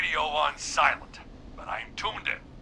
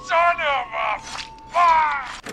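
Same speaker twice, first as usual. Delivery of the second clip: furious outburst, much higher and louder; angry shout; 8 semitones higher, 6 dB louder, much slower than usual. Real, not acted. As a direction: yell it in frustration. Killer already searched there. (grito de raiva)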